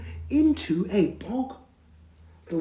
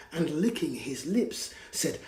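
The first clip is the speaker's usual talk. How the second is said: usual speaking voice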